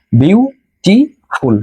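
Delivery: বিউটিফুল drawn out in three separate syllables, bew-ti-ful; broken into syllables. Shown as a demonstration. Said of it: "এটাকে ভেঙে ভেঙে উচ্চারণ করতে হয়".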